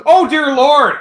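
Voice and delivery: high, loud cry of amazement; shocked exclamation. Retold or imitated. Guitarist stunned hearing the pedal, high loud exclamation. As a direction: blurt it out, stunned by the tone.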